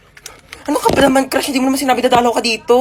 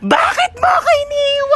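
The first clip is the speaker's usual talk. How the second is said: much higher, slow sobbing cry; hammed-up wailing; 11 semitones higher than usual. Mourner wailing at the coffin, hammed-up sobbing shout. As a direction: wail it like an over-the-top mourner.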